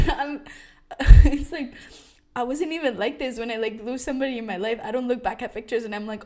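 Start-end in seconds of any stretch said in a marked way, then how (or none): 0.0-1.7 louder bursts of laughter. Real, not acted. Vlogger cracking up about missing her camera, loud laughter.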